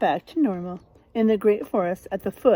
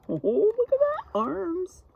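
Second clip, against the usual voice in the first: delighted surprise; 10 semitones higher than usual. Real, not acted. Teacher delighted at a child's arms.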